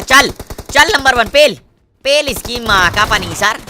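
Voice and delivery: loud push to charge; hyped loud rallying cry; urging shout. Real, not acted. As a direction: egg your teammate on. Urging teammate to attack.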